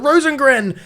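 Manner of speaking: high, loud goal yell; shouting name. Real, not acted.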